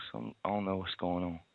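half to yourself, voice dropped to a whisper; muttered whisper. Real, not acted. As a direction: whisper it under your breath, baffled. Caller muttering to himself, confused.

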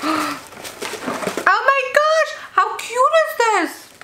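very high, drawn-out squeal; delighted squeal. Real, not acted. Vlogger opening eye masks, squealing with delight. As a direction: gush over the cute masks.

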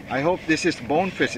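normal talking voice

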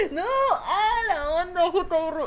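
high, loud words through laughter; laughing out the name. Real, not acted. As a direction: talk while cracking up. Still laughing after chat joke.

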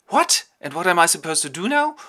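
high, loud cry of alarm; a startled father's loud outburst; shocked old count. Acted. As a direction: jolt up in shock at the news.

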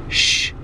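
sh as if hushing someone; a soft sh like telling someone to be quiet; shushing SH. Shown as a demonstration. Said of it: "telling someone to be quiet".

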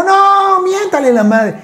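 high, pushy mock insistence; insistent urging. Old man in the street urging the actor to swear, high insistent.

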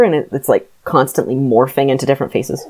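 ordinary delivery

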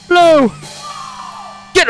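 high loud frantic call; frantic shout. Real, not acted. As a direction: shout it out in a rush. Frantic memory game recall.

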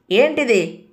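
mild narrated shout; shouted question. A narrator's reading. Customs man challenges Adil over ring box, mildly shouted. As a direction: sharpen it into a restrained shout. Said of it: "గట్టిగా అరిచాడు".

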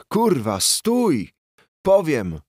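high, loud panicked yell; desperate scream for mercy. Acted. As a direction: shout it out as the blows land. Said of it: "wrzasnął".

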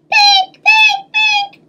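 very high sing-song beeping; alarm chime. Acted. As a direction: chirp it like a pink alarm clock. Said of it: "went my alarm".

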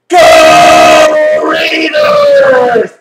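long, drawn-out megaphone cheer; megaphone yell. Retold or imitated. Fan re-enacting childhood game cheers, megaphone yell. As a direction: yell it up in the air through a megaphone. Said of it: "I'd be yelling up in the air".

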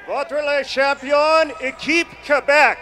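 slow, high stadium shout. Real, not acted. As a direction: call out the champions. Trophy announcement over PA.

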